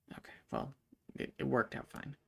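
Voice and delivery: usual speaking voice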